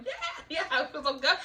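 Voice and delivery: high squealed cheer, then settling; high-pitched acted delight; retold elation. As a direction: reenact a jubilant reaction. Retold joy at the arrest.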